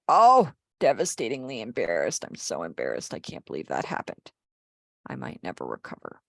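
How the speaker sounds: sinks low and fades to a hushed, mortified moan; devastatingly embarrassed.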